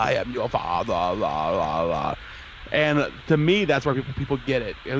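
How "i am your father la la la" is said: a mock fan-distorted voice, dropping low on la la; talking into a fan. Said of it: "where he's talking into the fan".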